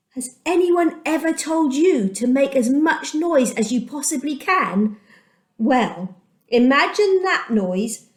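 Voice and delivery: plain reading voice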